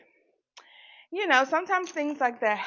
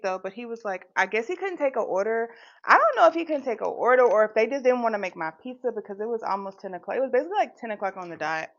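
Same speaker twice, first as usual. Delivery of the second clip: ordinary delivery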